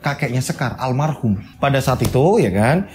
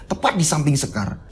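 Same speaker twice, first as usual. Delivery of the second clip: usual speaking voice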